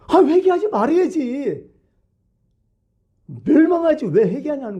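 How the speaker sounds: high-pitched mock whining protest; exasperated complaint. Preacher voicing Jonah upset that Nineveh repented, exasperated. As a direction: push the pitch up in indignant disbelief. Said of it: "스트레스 팍팍 받는 거지".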